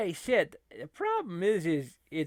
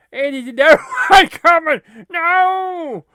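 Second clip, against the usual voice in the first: much louder, higher laughing yell; laughing shout; 13 semitones higher, 19 dB louder than usual. Real, not acted. Gamer yelling as yet another attack lands. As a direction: holler it out in delighted alarm. Reacting to game onslaught.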